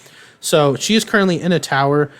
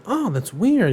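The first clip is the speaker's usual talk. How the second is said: high mock puzzlement; 7 semitones higher than usual. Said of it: "He's just like".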